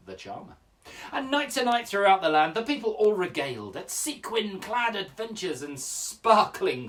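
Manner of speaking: loud, theatrical tale-telling with big swings in pitch; story poem recital. Acted. Telling children the tale of Sir Fabulous, grand storytelling.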